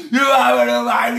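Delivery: slow loud shout. Real, not acted. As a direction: bellow it out, slowly. Ranting at listener.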